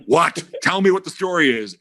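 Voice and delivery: high-pitched imitation of an impatient reporter; mock insistent demand. Janofsky pressing Dave for the story, acted insistence. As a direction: demand the scoop, playing it frantic.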